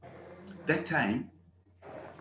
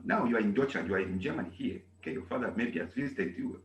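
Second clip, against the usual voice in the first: ordinary talk, nothing marked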